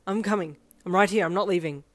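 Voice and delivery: loud, firm, breathless promise; insistent, protective urgency; urgent reassurance. Acted. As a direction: reassure hard while out of breath. Running rescuer reassures caller.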